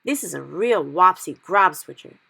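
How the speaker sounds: slow, loud emphatic giant. Acted. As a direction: hammer it out slowly. Giant stresses the nightmare.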